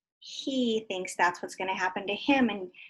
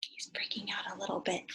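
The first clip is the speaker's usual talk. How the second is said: whisper; 3 semitones lower, 8 dB quieter than usual. Acted. Whispered aside to children.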